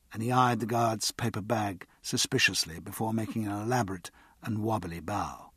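usual speaking voice

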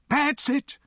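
high, loud cheering shout; excited shout. A narrator's reading. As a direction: urge your dog on at full voice. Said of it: "shouted Mister T".

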